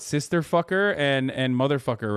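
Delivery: ordinary talk, nothing marked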